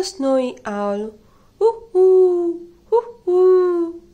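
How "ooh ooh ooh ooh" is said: high, loud owl hoot; playful high owl call. Pretend. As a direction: hoot like a snowy owl for the kids. Owl call in picture book.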